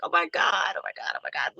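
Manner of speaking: whispered, choked-up mock sob; a hushed, tearful "oh my God"; retold crying. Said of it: "I started crying".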